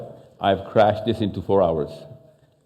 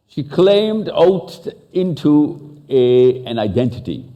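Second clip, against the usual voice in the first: emphatic, slow; 3 semitones higher, slower than usual. Real, not acted. Speaker stresses how Maha's mother took an identity, slow and emphatic. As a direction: spread each word out for emphasis.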